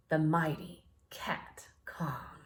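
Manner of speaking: slow, whispered monster reveal; dramatic whisper. A narrator's reading. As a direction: breathe the name with awe.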